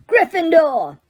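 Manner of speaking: shouted. A narrator's reading. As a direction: announce it to the hall. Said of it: "the hat had shouted".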